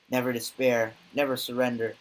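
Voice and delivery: usual speaking voice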